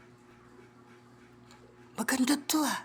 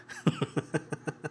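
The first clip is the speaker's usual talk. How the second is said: low breathy laugh; quiet laugh; breathy chuckle; 9 semitones lower, 8 dB quieter than usual. Real, not acted. Laughs after teasing.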